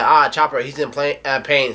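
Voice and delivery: usual speaking voice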